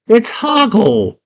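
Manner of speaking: high, drawn-out cry; hammed-up triumphant announcement; excited reveal. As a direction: herald the hero high and slow. Announces hero's sudden return.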